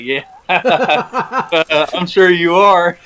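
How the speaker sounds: laughing, high retold sarcastic reply; teasing disbelief. Angler answers his friend's apology over the phone, laughing and disbelieving. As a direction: chuckle through it, then slow mock agreement.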